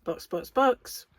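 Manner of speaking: slow drawn-out team radio call; pit radio call. Pretend. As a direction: call the pit stop slowly over the radio. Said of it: "this is me box, box, boxing for a pit stop".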